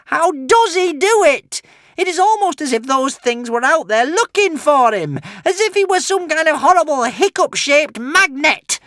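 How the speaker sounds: high, loud crazed witch voice; cursing witch. Acted. The witch cursing her luck, high and crazed. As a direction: spit the curse like a mad witch. Witch ranting about Hiccup.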